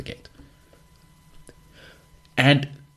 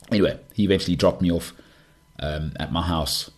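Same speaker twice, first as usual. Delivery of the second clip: normal talking voice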